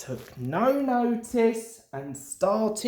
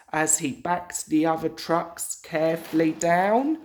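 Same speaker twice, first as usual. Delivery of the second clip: ordinary delivery, nothing marked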